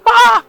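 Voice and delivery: sudden high loud shriek of fright; startled scream. Acted. Squirrel sees the killer bee, shrieks in fright. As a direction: cry out in terror at the bee.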